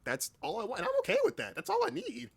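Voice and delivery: usual speaking voice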